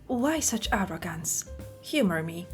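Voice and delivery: ordinary talk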